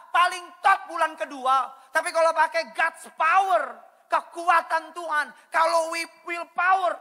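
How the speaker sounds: loud, high, forceful sermon delivery; full-force preaching. Real, not acted. Pastor warning that God's defence is no licence, full-force preaching. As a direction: thunder it out to the congregation.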